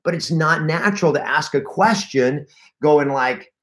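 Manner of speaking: ordinary talk, nothing marked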